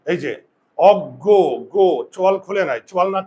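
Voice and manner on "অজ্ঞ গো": "অজ্ঞ" as og-go, loud, final o stressed; closed-jaw o sound. Shown as a demonstration. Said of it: "চোয়াল বুঝে থাকলে উকার হয়".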